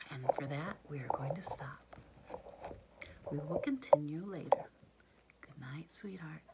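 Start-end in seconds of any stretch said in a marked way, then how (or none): 0.1-1.8 bedtime whisper. Real, not acted. Bedtime, child falling asleep. 5.4-6.4 bedtime whisper. Real, not acted. Saying good night to child.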